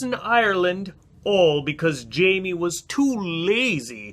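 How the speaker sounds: put-on storybook narration in an Irish accent; Irish story narration. A narrator's reading. Reading to listeners, Irish-accented story narration. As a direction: spin the tale like an Irish storyteller.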